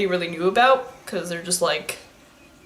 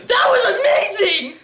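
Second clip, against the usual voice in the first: high, laughing exclamation; real thrilled laughing cheer; excited laughing cry; 11 semitones higher, 3 dB louder than usual. Real, not acted. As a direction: burst out in delighted laughter. Reacting to the anime scene.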